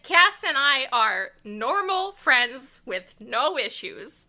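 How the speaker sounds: loud, slow, over-emphatic denial; loud and slow mock defensive; insistent Dean. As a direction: hammer it home slowly and loudly, protesting too much. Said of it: "And Dean's like".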